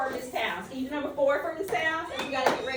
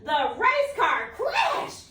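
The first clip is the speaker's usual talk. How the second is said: higher, louder, drawn-out excited news; teacher acting out a child's excited report; excited announcement; 8 semitones higher, 4 dB louder, much slower than usual. Shown as a demonstration. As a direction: tell the teacher like it's big news. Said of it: "and you say".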